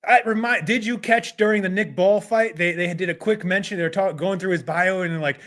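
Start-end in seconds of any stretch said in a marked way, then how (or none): none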